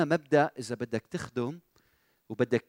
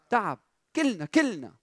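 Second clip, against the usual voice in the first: ordinary talk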